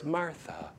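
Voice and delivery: soft, fading to a near whisper, tender and tearful; tender plea. Retold or imitated. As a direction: plead gently, almost whispering. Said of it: "a tear coming from his eyes".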